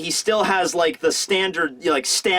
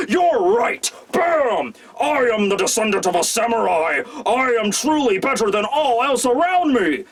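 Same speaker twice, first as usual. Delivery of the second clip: boastful shout; 4 semitones higher than usual. Retold or imitated. Urashima at the diner throwing his food, shouting. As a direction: shout it like a puffed-up warrior. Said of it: "and he's just like".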